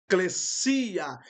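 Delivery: eclesia said slowly, stress on the final syllable; correct pronunciation. Shown as a demonstration. Teacher shows the class the eta pronunciation, slowly. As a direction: eclesia with closed e and the stress at the end. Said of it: "a pronúncia é com eta".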